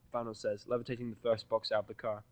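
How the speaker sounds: ordinary delivery, nothing marked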